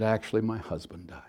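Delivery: softly whispered confession of loss; retold whisper. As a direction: breathe it out, barely voiced. Grieving woman's retold confession.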